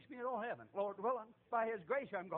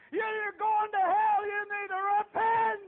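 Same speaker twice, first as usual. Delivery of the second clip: very high, very loud, slow warning; loud, high street sermon yell; preaching shout; 12 semitones higher, 14 dB louder, slower than usual. Retold or imitated. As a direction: bellow it at the man. Preaching at approaching cop.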